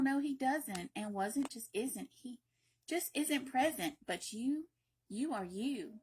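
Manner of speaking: plain reading voice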